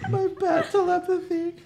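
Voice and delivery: high jokey echo of a bat-hero voice; Batman voice. Retold or imitated. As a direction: parrot the player in a silly superhero voice. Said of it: "the Batman voices".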